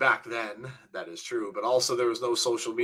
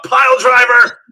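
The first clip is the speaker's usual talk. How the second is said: sudden loud exclamation; loud commentator outburst at a big move; excited match call; 10 semitones higher, 15 dB louder than usual. Real, not acted. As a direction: burst out with the wrestling move.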